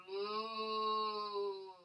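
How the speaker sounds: long, low, soft moo; playful cow moo. Pretend. Teacher showing the class cow pose, low mooing. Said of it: "The opposite is a cow pose".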